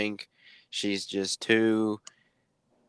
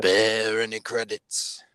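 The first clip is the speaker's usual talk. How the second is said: slow, distant bounty hunter voice; Boba Fett voice; slower than usual. Retold or imitated. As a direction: deliver it slow and menacing like a masked bounty hunter. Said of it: "Boba Fett".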